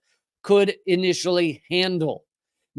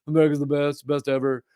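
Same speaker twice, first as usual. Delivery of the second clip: a lightly done Trump, lower and boastful; Trump impression; 5 semitones lower, faster than usual. Mocking a Russia-hater praising America, light Trump voice. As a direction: brag like a mild Trump imitation. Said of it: "talk in that Trump voice".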